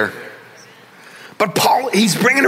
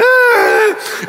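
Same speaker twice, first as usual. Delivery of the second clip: a high falsetto wail, sobbing while driving; mock sobbing wail. Pastor reliving crying on his four-wheeler, high wail. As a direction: cry out high like a man undone by tears.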